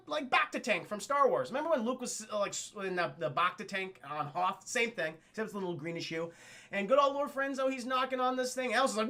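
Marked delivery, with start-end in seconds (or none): none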